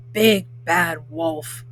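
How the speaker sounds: sarcastic spooky growl on the nickname; mock scary voice. Wolf mocking his own nickname, low and slow. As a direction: drop low and slow for big bad wolf.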